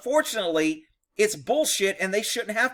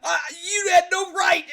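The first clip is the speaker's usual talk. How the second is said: whiny offended cop; 12 semitones higher than usual. Retold or imitated. Other cops scolding Rick for embarrassing them, high whining shout. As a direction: whine it like an insulted officer, voice cracking. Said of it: "Other guys would be like".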